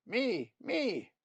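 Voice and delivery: high piping chick voice; a small bird pleading for its turn; begging nestling. Acted.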